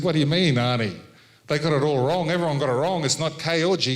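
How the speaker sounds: protest, a bit worked up; retold protest.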